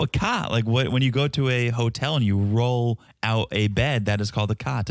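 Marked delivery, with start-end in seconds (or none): 0.0-0.5 "cot" pitched up in Boston vowel; Boston accent. Real, not acted. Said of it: "it's my Boston accent".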